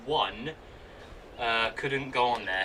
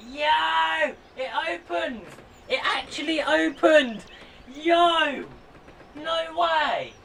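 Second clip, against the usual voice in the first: excited; 6 dB louder, slower than usual. Real, not acted. Disc opens, celebrates.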